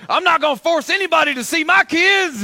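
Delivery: high, loud impersonation of a bitter poster; mock aggrieved rant. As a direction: protest loudly, mimicking a wounded complainer. Voices an aggrieved social media post.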